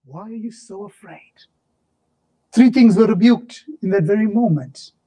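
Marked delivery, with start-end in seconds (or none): 0.0-1.3 whispered rebuke; Jesus rebuking his disciples in a whisper. Retold or imitated. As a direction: drop to a breathless whisper.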